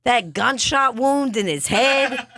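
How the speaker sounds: high-pitched, worked-up objection; hammed-up exasperation; exasperated protest. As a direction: plead back in a high, indignant voice. Arguing with the client in character.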